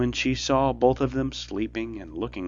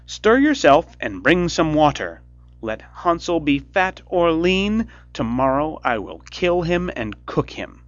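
loud, higher impatient witch; cried; 7 semitones higher, 8 dB louder than usual. A narrator's reading. Impatient witch ordering Gretel, loud threatening cry. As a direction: snap out the threat loudly. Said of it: "she cried to the girl".

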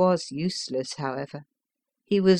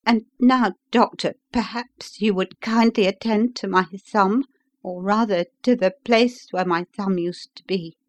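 higher, polite patient's voice; injured visitor; 3 semitones higher, 3 dB louder than usual. Acted. Injured engineer asks the doctor to see his hand, higher visitor's voice. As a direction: play the injured man making his request.

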